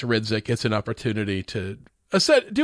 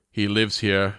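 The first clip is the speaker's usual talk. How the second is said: put-on Arnold accent; 6 semitones lower, slower than usual. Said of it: "he's like".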